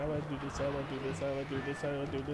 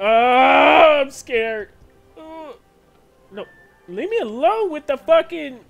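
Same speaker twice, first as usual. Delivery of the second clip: normal talking voice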